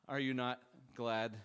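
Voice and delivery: ordinary talk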